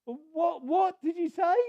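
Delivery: high, loud disbelieving shout; can't believe what he heard; incredulous yell; shocked shout. Retold or imitated. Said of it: "said Jack".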